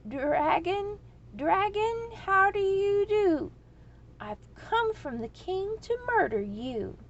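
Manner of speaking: high, loud, slow challenge; a trembling boy forcing a bold shout; loud and firm cry. A narrator's reading. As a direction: call out as bravely as he can manage. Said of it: "As loudly and firmly as he could manage".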